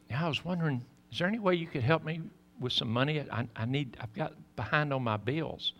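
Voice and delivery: softly voiced hushed plea; hushed caller. Caller at the church softly asking the pastor for money. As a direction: lightly play a woman asking quietly. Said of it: "I knew she was whispering".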